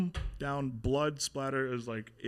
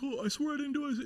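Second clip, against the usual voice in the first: much higher, panicked begging; mock pleading friend; faster than usual. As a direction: imitate the friend begging for his life. Friend pleading under interrogation.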